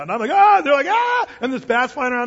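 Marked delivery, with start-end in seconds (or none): 0.3-0.6 short freaked-out scream; imitated panicked yell. Pastor freaking out at the bat, imitated scream. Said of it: "the kids are freaking out". 0.9-1.3 high, loud mock scream; imitated kids screaming. Kids to pastor as the bat flies, imitated scream. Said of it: "the kids are freaking out".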